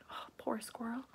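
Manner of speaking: whisper. Real, not acted. Reader's aside to listener.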